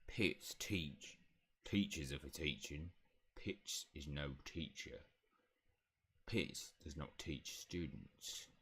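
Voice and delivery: low, slow, quiet house elf; Pitts. Acted. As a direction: refuse slowly in a low elf voice. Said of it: "elf voice".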